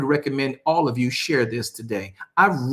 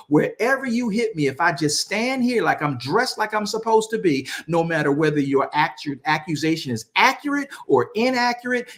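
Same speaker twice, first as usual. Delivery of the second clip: driving sermon cadence in rhythmic repeated phrases; fervent preaching; 6 semitones higher than usual. Acted. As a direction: preach it with rising conviction, pitched above plain talk. Preaches to congregation.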